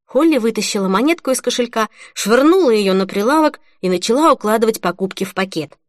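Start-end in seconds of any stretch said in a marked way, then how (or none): none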